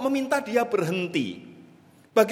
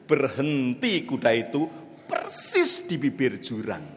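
ordinary talk

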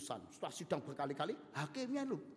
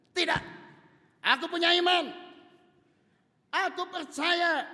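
loud, high cry of faith; defiant wife; 14 dB louder than usual. Acted. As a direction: shout it out, refusing to yield. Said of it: "Dia bilang".

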